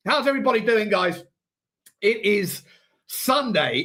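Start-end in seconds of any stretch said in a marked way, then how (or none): none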